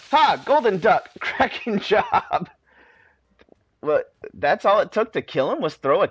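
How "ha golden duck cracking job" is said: lightly British, higher, amused; hint of British cheer. Scientist congratulating the player, light British voice. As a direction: cheer the hit in a posh-ish accent.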